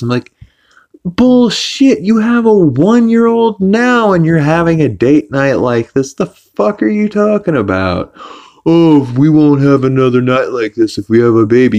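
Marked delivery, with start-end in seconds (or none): 1.0-8.1 high-pitched, incredulous rant; hammed-up indignant outburst; outraged retort. Acted outraged retort to the couple. 8.7-11.8 raised, whiny mimicry of the couple; sarcastic mock voice; mocking imitation. Mocks the couple's words back.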